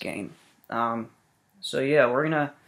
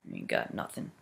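taunt; 13 dB quieter than usual. Acted. Taunting enemy in low voice.

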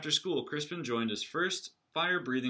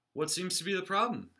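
doctor; 8 semitones higher than usual. Acted. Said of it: "asked the doctor".

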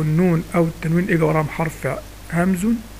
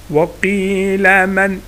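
تلاوة بسكتة؛ "من راق" بطيئة جدًا مع سكتة لطيفة بين الكلمتين؛ اقطعها ببطء: من... راق؛ تلاوة بطيئة مع سكتة; much slower than usual. Shown as a demonstration. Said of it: "لو أنا هوصلها من راق".

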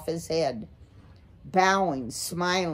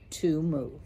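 plain reading voice

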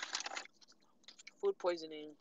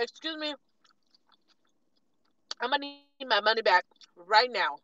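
loud, firm demand; demanding money back; 3 semitones higher, 11 dB louder than usual. Retold or imitated. Demanding a refund from the worker, loud and firm. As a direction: lay down the law.

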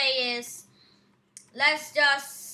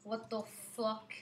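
normal talking voice

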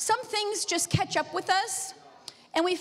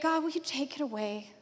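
softer, pleading ask of God; hushed prayer; 2 semitones lower, 6 dB quieter than usual. Retold or imitated. Preacher quietly voicing her own prayer to God. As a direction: murmur the plea like a private prayer.

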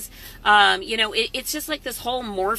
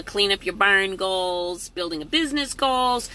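normal talking voice

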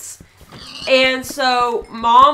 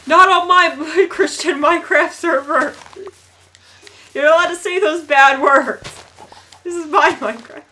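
mock scolding; 5 semitones higher, 5 dB louder than usual. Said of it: "I can't even say that with a straight face".

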